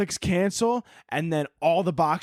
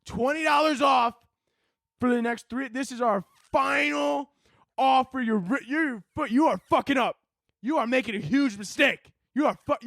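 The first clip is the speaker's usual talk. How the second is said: high, insulting shouted offer; pushy company shouting; 8 semitones higher than usual. Retold or imitated. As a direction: bark the final offer, scolding. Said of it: "But they're like".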